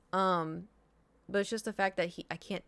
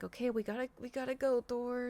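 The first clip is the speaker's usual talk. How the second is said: gentle to pet; 5 semitones higher, 3 dB quieter than usual. Real, not acted. Gently rousing her sleepy cat.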